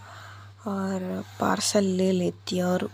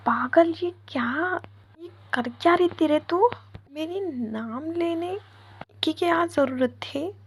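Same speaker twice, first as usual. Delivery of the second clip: much higher, sharp telling-off; exasperated scolding; 8 semitones higher than usual. Acted. Viya scolds Komal on the phone, high and exasperated. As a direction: snap at her friend, half-whispered and shrill.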